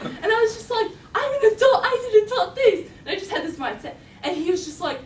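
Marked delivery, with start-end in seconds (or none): 1.1-2.9 high, loud protest; defiant teen. Retold or imitated. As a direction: insist shrilly in a hammed-up teenage argument. Retold teen protest to dad.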